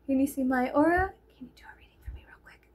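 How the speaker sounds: secretive whisper, rushing at the end. Retold or imitated. Girl sneaking up to ask for a reading, whispered. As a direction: hush it like a secret request. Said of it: "she came right up to me and she was like".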